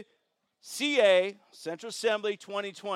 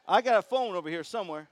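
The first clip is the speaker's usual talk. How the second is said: normal talking voice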